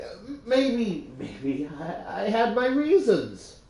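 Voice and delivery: high-pitched, stammering self-defence; flustered defensiveness. Acted. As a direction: stumble into a defensive retort, pitch jumping up. Acted defensive retort on the phone.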